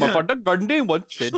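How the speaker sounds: comic Indian accent with a chuckle. Shown as a demonstration. Reluctantly showing friends his phrase, Indian accent. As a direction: put on a hammed-up Indian accent. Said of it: "You have a good Indian one too".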